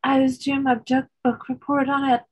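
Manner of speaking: cheeky mock Derry accent; lightly put-on Northern Irish Derry schoolgirl. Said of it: "We're doing the Ger girls' accents".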